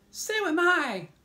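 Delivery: very high, loud ladybug cry. A narrator's reading. Ladybug chimes in that she is hungry, high cry. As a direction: call it out high and drawn out. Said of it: "the ladybug cried".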